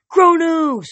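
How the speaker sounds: high, loud rallying cry; shout. A narrator's reading. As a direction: call the name out like a cheering crowd. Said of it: "they shouted".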